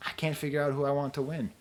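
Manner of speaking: ordinary talk, nothing marked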